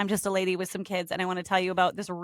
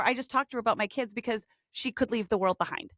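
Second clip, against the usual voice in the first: ordinary talk, nothing marked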